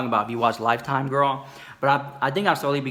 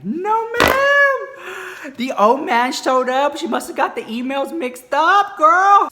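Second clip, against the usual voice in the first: high, loud, drawn out disbelief; shocked outcry; 7 dB louder, much slower than usual. Real, not acted. Telling viewers the twist, high loud shock. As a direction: squeal it in disbelief. Shock the old man came.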